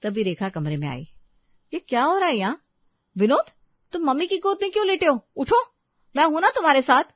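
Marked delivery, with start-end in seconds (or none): 1.7-7.0 high, loud, jealous demand; angry wife. Acted. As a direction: confront the husband sharply. Wife walks in, confronts husband. (गुस्से में टोकती पत्नी)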